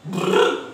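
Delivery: lip-trilled vroom; scooter engine noise. Acted. Friend teasing bhaiya ji about his new scooter, imitated engine noise. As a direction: buzz the lips like a revving scooter.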